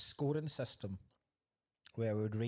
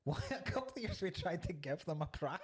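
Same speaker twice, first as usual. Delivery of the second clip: talking through laughter, pitched up; giggling through the words; laughing; 8 semitones higher than usual. Real, not acted. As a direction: laugh the story out. Laughing while telling.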